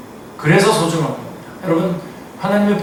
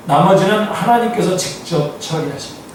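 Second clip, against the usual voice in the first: ordinary delivery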